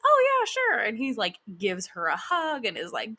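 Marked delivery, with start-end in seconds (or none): 0.1-0.8 high, loud, chirpy yes; breezy agreement. Retold or imitated. Friend agreeing to take a picture, imitated breezy high voice. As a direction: agree readily in a much higher voice.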